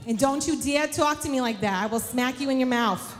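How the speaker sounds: hammed-up New York mom's threat; New York accent. Mary ordering Jesus home, in a mock New York accent. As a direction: scold like a no-nonsense mother with a New York accent. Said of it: "I don't know why she just went into New York".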